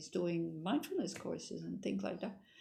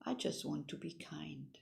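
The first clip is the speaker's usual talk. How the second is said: soft quote; 3 semitones lower, 8 dB quieter than usual. Retold or imitated. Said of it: "And Ursel said".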